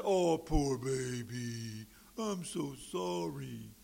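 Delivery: slow, mocking baby talk of pity. The Lord mocking the complainers, slow sarcastic pity. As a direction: coo it like comforting a whiny child. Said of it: "And the Lord said".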